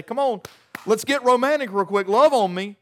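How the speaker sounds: loud, high, hurried come-on; pushy mock romance. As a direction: play a pushy lover demanding romance now. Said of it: "We show up at the candlelit dinner and say".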